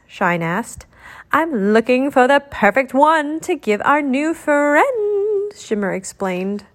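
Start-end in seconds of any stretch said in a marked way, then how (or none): 1.3-5.5 higher, eager storybook character voice; eager young genie. A narrator's reading. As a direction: lift the pitch and voice an excited genie.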